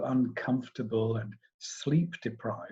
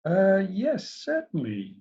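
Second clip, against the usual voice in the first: uncertain; 7 semitones higher, slower than usual. A narrator's reading. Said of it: "Hugh replied uncertainly".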